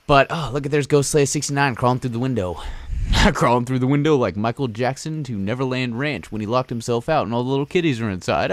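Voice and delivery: ordinary talk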